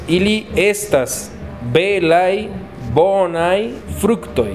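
slow model answer. Shown as a demonstration. Teacher models the full answer slowly for the student. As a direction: spell the sentence out slowly.

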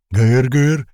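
loud barked urging in another voice; shouted hurry-up. A narrator's reading. Old man shouts for everyone to hurry, loud bark. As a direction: yell to drive them on. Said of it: "закричал дед".

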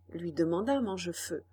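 ordinary delivery

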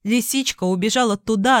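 plain reading voice

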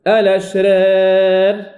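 الأشرار recited slowly, final alif leaning toward e; imala in recitation. Shown as a demonstration. Teacher recites examples of imala. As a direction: draw out الأشرار with the alif tilted to e. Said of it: "كذلك".

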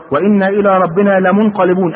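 slow, stretched recitation; acted prayer call, measured; leading a prayer. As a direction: lead the passengers through the supplication. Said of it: "يلا ورايا يا جماعة".

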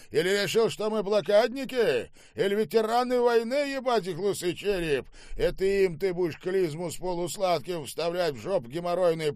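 loud, high-pitched tirade; enraged ranting. Acted. Boss rages at the manager over sweet champagne, enraged. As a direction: keep the abuse at full pitch to the end.

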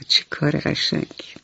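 put-on whispered چه کار قشنگی to young listeners; whispered echo. Shown as a demonstration. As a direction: repeat چه کار قشنگی as a soft breathy whisper.

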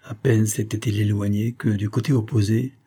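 plain reading voice

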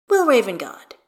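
plain reading voice

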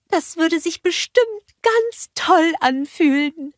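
blissful snowman; Olaf's voice. A narrator's reading. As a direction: savour the best day ever. Olaf voiced in story.

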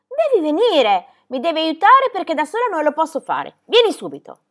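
high, loud demanding shout; insistent order. A narrator's reading. Girl needs the goatherd's help, insistent shouted order. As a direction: shout it urgently, pitched well up.

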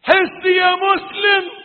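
high, very loud preacher's shout; shouted plea. Real, not acted. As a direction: cry out to wake the believer. Same appeal, hushed then shouted. (صيحة وعظية عالية)

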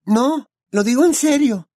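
high, loud, emphatic denial; surprised exclamation. A narrator's reading. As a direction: Hermione earnest and astonished. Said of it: "exclamó Hermione sorprendida".

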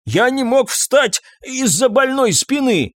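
high, drawn-out whine; wounded, high-pitched excuse; indignant protest. A narrator's reading. Husband protests wife's taunt.

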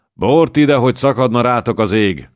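a furious knight bellowing for wine; bellowing demand. A narrator's reading. Knight roars at the innkeeper for wine, bellowing. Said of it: "harsogta egy német képű lovag".

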